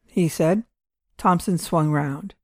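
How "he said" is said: ordinary delivery, nothing marked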